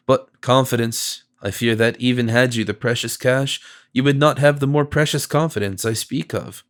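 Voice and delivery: ordinary delivery, nothing marked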